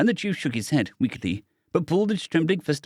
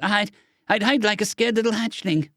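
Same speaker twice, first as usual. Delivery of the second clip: croaked; 10 semitones higher than usual. A narrator's reading. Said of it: "Cadet looked away and croaked".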